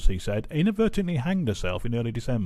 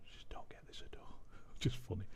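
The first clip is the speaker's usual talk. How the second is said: breathy disbelieving whisper; whispered aside; 17 dB quieter than usual. Real, not acted. Vlogger to viewers, baffled whisper. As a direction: mutter it under your breath, baffled.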